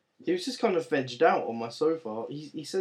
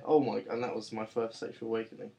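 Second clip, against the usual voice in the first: ordinary talk, nothing marked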